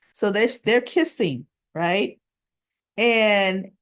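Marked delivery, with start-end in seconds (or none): none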